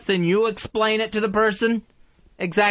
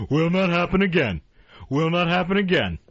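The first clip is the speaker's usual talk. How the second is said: lower-pitched imitation of a stock promise; mock promise; 4 semitones lower than usual. Host quoting a promise he keeps hearing, low mocking recital. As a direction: drop the pitch and recite it like a rote assurance.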